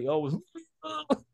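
words break high into a squeak on up; squeaky drunk greeting with a laugh; mock drunk greeting, voice cracking high; drunk reply. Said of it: "I was like".

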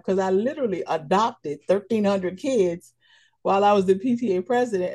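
ordinary talk

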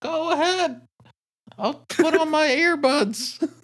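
high-pitched pretend mom, slowing at the end; mock-sweet mom voice; obliging mom. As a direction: play an all-too-accommodating mother up high. Plays the obliging mom overhearing.

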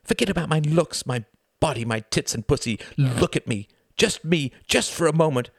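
high, loud, escalating tirade; furious wife, voice climbing; sharp, angry. A narrator's reading. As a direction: build from hard demand to shrill challenge. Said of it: "her voice like sharp bricks of ice".

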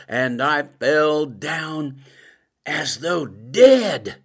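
slow, weighty, each word drawn out; slow solemn reading. Shown as a demonstration. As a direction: read the verse slowly as scripture. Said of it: "It says".

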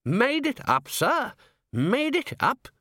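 put-on very high, scandalised old man; indignant old herald. Herald overhearing Vimes's doubt, high indignant old-man voice. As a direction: squeak up high in affronted disbelief.

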